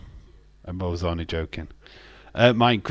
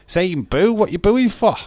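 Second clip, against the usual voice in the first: indignant protest; 9 semitones higher, 6 dB louder than usual. Real, not acted. Chat says hi then boos.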